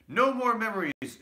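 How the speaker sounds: high, loud furious shout; loud angry cry. A narrator's reading. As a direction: drive the memories away. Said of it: "shouted Scrooge".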